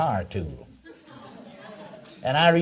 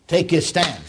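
usual speaking voice

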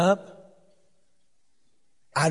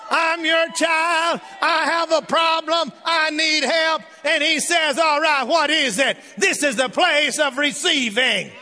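full-force preaching; 7 semitones higher than usual. Acted. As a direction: shout the good news to the congregation.

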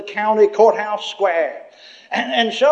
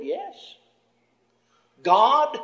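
ordinary delivery

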